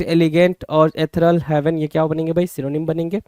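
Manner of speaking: ordinary delivery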